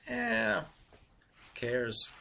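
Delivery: a lightly voiced dismissive "Eh, who cares?"; indifferent shrug of a voice. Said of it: "I'll just go".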